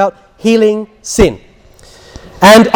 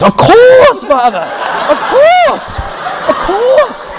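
very high, drawn-out cry; hammed-up high-pitched old faithful's assent; imitating excited patient; 13 semitones higher, much slower than usual. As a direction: squeal it in comic eagerness. Patient acted bursting into reply.

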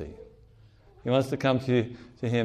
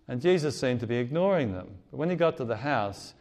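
ordinary delivery

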